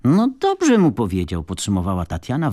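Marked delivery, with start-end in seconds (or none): 0.0-1.4 high, loud burst of approval; emphatic, punched-up endorsement. A narrator's reading. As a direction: cheer the verdict on, loud and high. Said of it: "waląc dłonią w blat".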